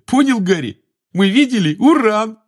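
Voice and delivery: high, loud, daft excitement ending in laughter; giggling. A narrator's reading. Addled Ron repeats his joke to Harry, giggling. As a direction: crack up at your own silly joke. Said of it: "всё ещё хихикая".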